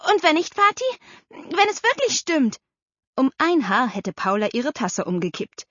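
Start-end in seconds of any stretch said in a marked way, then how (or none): 0.1-2.6 put-on high, pleading child's voice; excited daughter. A narrator's reading. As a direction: press Dad with a hopeful question. (aufgeregte Tochter, hohe Kinderstimme)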